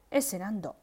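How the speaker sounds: ordinary delivery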